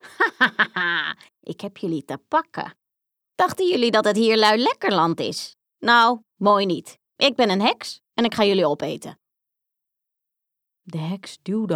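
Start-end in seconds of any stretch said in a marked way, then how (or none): 0.1-2.8 mean laugh. Acted. Witch gloats over the trapped children, laughing meanly. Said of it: "lachte gemeen". 3.3-9.2 menacing witch. Acted. Witch threatens Hans and Grietje, loud and menacing. Witch reveals she will eat them.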